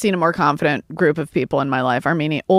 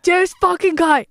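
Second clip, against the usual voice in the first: high, slow furious spit; 12 semitones higher than usual. Retold or imitated. Retelling road rage to podcast friends, high furious mutter. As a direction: seethe it out in a high pitch.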